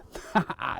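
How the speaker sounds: short, soft chuckle; amused laugh. Real, not acted. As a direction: chuckle at your own small catch. Amused that fish get smaller.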